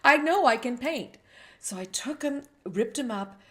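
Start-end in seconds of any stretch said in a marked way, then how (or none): 0.0-1.2 indignant inner voice. Retold or imitated. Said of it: "I was like".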